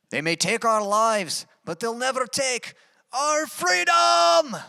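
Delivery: high, slow Scottish battle cry building to a shout; rally the troops in a hammed-up Scottish accent; Scottish accent, shouted slowly. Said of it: "Tried to do my best accent".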